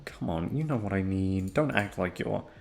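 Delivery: normal talking voice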